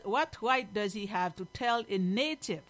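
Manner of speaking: ordinary talk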